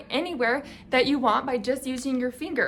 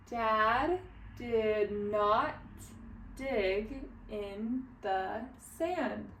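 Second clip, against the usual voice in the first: careful separate words, slightly more joined; a little faster; 6 dB quieter, much slower than usual. Shown as a demonstration. Teacher rereads the line for learners, slow but a little faster. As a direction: still very slow, deliberate word-by-word reading. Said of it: "read it a little bit faster".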